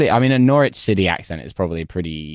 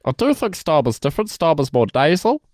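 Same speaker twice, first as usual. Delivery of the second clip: higher, measured politician's voice; Keir Starmer impression; 10 semitones higher than usual. Mocking Starmer's voice for podcast friends, higher politician impression. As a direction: do the Labour leader, earnest and careful. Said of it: "the voice is very close".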